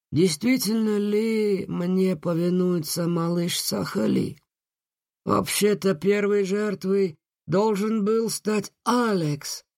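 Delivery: высокий, медленный зловещий джин; smug, slow villain voice; sinister genie. Acted. Джин угрожает девочке. (зловещий голос джина)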